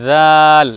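জাল said soft and drawn; soft Arabic letter. Shown as a demonstration. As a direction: gently stretch জাল. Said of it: "উচ্চারণে নরম হবে". (জাল নরম করে উচ্চারণ)

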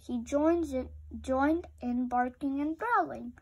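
ordinary delivery, nothing marked

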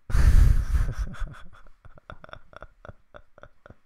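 loud burst trailing into chuckles; real hearty laugh. Real, not acted. Host about to share guru's story, laughing hard. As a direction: burst out laughing, then let it peter out. Amused before sharing the story.

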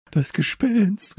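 high, loud, drawn-out cry of alarm; frightened cry. A narrator's reading. Two children think they see a ghost, crying out. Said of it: "riefen Leo und Samson".

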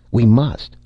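a startled, frightened outburst; screamed in horror. A narrator's reading. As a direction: cry out in sudden horror. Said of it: "he screamed in sudden horror".

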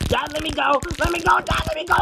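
rising panic; panicked pleading. Acted. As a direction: struggle to get free of a crushing hug. Son squeezed in too-tight hug.

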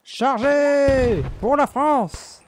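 high, slow drawn-out war cry; rally the troops, stretching every word; battle cry. Pretend. In-game charge, rallying the troops.

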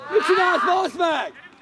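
high, very loud cursing question; angry shout. Real, not acted. Spectator demanding what the player is doing, shouting angrily. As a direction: yell in exasperation at a player.

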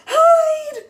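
high, loud, drawn-out exclamation; excited. Shown as a demonstration. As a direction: shout it with a big burst of excitement. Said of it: "so we have to sound excited".

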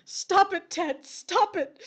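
high, loud, rising to a cry; hysterical pleading. Acted. Widow pleading with dead husband, hysterical. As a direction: beg him to stop, near panic.

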